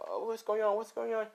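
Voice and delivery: put-on high, panicky boy's voice; scared. Deku alone in the woods at night, scared and high. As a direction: voice jumps up in fright. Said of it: "As he's a little scared right now".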